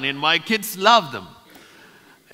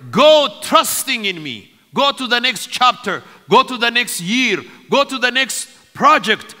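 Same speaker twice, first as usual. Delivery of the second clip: raised, forceful voice in driving repetitions; commissioning preacher; 5 semitones higher, 6 dB louder than usual. Real, not acted. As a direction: send the congregation out with authority.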